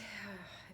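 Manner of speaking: soft sigh of release; short, soft relaxing sigh; releasing sigh. Shown as a demonstration. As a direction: let the breath go softly. Modelling relaxation for listeners.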